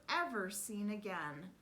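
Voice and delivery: ordinary delivery, nothing marked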